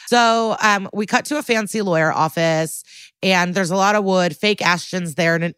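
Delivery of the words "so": ordinary talk, nothing marked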